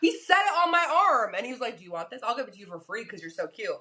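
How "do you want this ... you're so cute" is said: lower, softer, lightly voiced sleazy offer; a hint of a creepy stranger. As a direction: murmur the offer like a shady street dealer.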